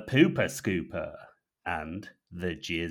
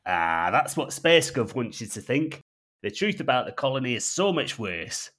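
put-on North Yorkshire accent; 4 semitones higher, 5 dB louder than usual. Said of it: "in his gruff North Yorkshire tone".

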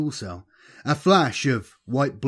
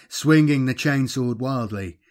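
plain reading voice

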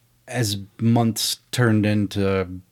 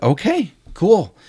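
high, slow retold acceptance; pleasantly surprised; 9 semitones higher, 3 dB louder than usual. Filmmaker reassured by his gung-ho partner, pleasantly surprised. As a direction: accept it in a raised, drawn-out voice.

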